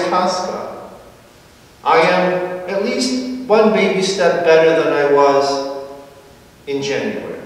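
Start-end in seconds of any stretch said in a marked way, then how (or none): none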